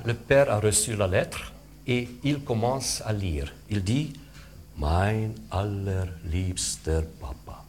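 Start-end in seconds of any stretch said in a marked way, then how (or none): 4.8-7.6 put-on slow, softer old man's reading. Shown as a demonstration. Father slowly reading his son's letter, soft. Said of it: "naturellement plus lent".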